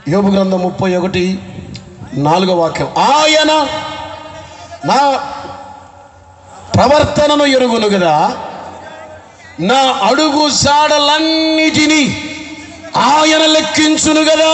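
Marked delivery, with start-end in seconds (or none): 2.9-14.5 high, slow declaiming of the verse; slow ringing scripture recital; scripture reading. Acted. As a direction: proclaim the verse with weight.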